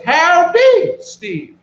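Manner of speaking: high, loud Southern howdy; Southern drawl. Acted. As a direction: greet in your best Southern drawl. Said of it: "in his best Southern drawl".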